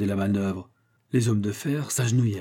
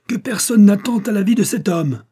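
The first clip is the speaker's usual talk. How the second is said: high, very loud command; formidable commanding voice; 11 semitones higher, 7 dB louder than usual. A narrator's reading. Father protecting the young couple, formidable shouted command. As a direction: thunder out an order. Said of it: "commanda-t-il d'une voix formidable".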